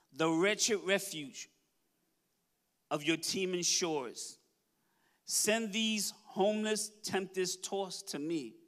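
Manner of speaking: slow, solemn poem reading; poem recitation. Acted. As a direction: read the inscription like a hymn. Recites the Statue of Liberty poem.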